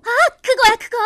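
sudden loud eureka; excited. Acted. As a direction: burst out with the idea. Sudden idea.

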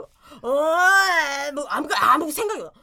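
put-on slow, slurred, drunken drawl; drunk slurring. Drunk husband on the phone admits he's in China, slurring. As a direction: sound drunk and carefree. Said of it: "술이 취해가지고".

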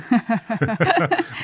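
short burst of laughter; bright laugh. Real, not acted. Amused by the chicken remark.

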